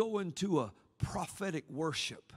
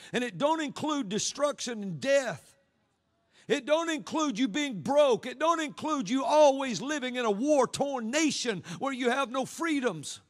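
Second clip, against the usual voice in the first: rising preacher intensity; 8 semitones higher, 6 dB louder than usual. Acted. Preacher rising to full force.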